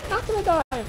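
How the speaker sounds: high, loud cry mid-fight; defiant shout. Real, not acted. Player fighting off enemies, defiant shout. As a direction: shout it while fighting for survival.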